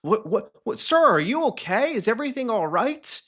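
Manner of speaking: imitating his own rattled phone-agent voice; alarmed concern. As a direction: sound thrown by the scream and checking on him. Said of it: "I'm like".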